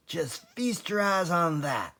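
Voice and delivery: high, gleeful show-off call; proud fox showing his treasure; Mister Fox crowing over the loot; excited cry. A narrator's reading. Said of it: "cried Mister Fox".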